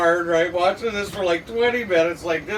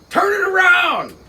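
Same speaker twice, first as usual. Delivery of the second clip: sharp shouted command from up high; yelling order; 11 semitones higher, 4 dB louder than usual. Retold or imitated. Boss yelling at the new guy on the scaffold, shouting. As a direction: bark it across the site.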